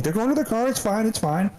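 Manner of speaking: high pitched placating voice; soothing owner. Retold or imitated. Owner reassuring his barking dog, high placating voice.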